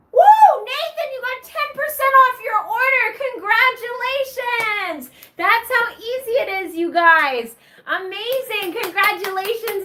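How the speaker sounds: high, loud, sing-song chant of what you gonna get; game show hype. Acted. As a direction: whip up the crowd like a game show host. Hyping a second prize wheel spin.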